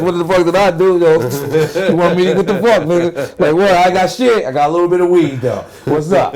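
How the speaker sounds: loud, high, jumpy outburst at a cop; panicked overreaction. Acted. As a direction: blurt it out defensively, mock panic. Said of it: "I'm gonna go like this".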